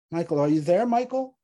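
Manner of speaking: rising, urgent call down the phone; wife alarmed by husband's silence. A narrator's reading.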